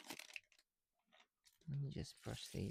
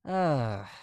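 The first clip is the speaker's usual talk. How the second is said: usual speaking voice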